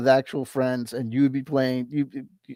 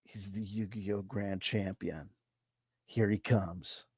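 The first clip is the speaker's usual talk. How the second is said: low, very soft, slow announcement; slow, reverent mock awe, kept low; mock hushed commentary; 6 semitones lower, 13 dB quieter, slower than usual. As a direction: hush it like a commentator as the champion arrives.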